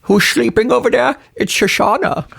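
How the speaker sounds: high, hammed-up Dutch accent. Cohost teasing the sleeping girl in a mock Dutch accent. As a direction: coo it in a silly Dutch accent. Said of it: "it's a Dutch accent".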